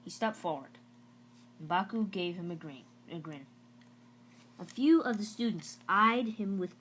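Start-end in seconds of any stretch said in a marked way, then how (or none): none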